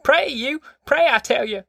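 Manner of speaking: very high-pitched, insistent granny; frantic grandma. A narrator's reading. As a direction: demand it high, as a frantic old woman. Grandma frantically orders Casey to pray.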